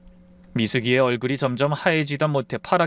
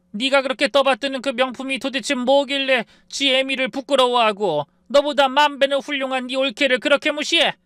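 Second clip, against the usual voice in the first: harsh scolding; 10 semitones higher than usual. Acted. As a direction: tear into her daughter. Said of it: "아주 호되게 꾸짖었습니다".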